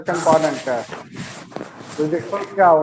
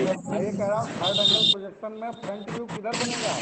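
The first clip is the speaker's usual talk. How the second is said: faint call audio; 9 dB quieter than usual. Real, not acted. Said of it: "आवाज नहीं आ रही है".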